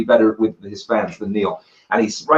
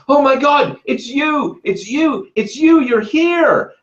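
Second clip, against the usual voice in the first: excited yell; 12 semitones higher, slower than usual. Retold or imitated. Starstruck fan yelling at the meteorite hunter. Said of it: "he's yelling".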